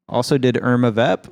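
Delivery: ordinary talk, nothing marked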